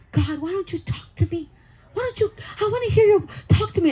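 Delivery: pleading prayer. Retold or imitated. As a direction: beg God to speak.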